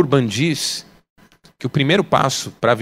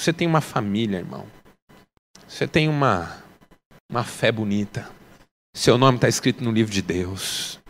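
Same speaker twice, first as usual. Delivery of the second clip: gentle appeal; 7 semitones lower, 5 dB quieter than usual. Real, not acted.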